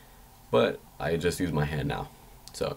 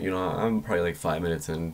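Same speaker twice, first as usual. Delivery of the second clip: normal talking voice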